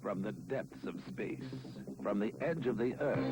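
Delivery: put-on old sci-fi announcer. Dramatic space-themed watch ad.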